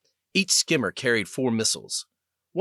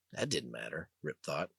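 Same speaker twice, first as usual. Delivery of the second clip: ordinary delivery, nothing marked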